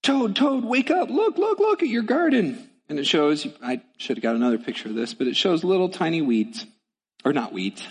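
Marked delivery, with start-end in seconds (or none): 0.0-2.7 high, eager frog voice calling his friend; excited Frog. Acted. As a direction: shake a sleepy friend awake, high and bright. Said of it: "Frog comes along and he says".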